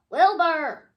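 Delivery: loud called name; shouting Wilbur. A narrator's reading. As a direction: bellow his name. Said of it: "shouted Charles and Henrietta".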